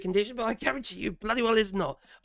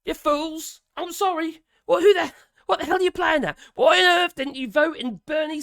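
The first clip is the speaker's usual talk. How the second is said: exasperated rant; 8 semitones higher, 6 dB louder than usual. Real, not acted. Ranting at American voters.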